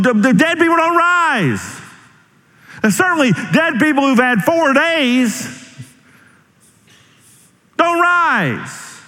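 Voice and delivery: high-pitched, incredulous protest; doubting bystanders. Acted. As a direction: protest in a high voice, stumbling over the objection. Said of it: "bystanders that would have said".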